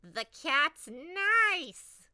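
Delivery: witch wailing. Acted. Witch appalled by nice kitten.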